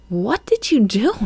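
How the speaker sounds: high amazed exclamation; exclaimed. A narrator's reading. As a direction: burst out in wonder. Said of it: "Marina exclaimed".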